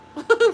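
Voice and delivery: sudden high, loud laugh; short loud high laugh; burst of laughter. Real, not acted. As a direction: crack up at yourself. Bursts out laughing mid-rant.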